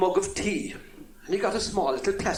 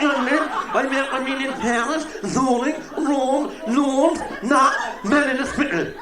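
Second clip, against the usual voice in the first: stammering man; 6 semitones higher than usual. Acted. Stammering man repeats his travels.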